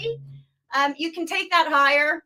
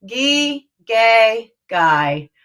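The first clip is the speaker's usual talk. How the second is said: bored drop down the scale; 2 semitones lower, 7 dB louder, much slower than usual. Shown as a demonstration. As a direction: sound like a kid who can't be bothered. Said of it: "Really bored, can't be bothered".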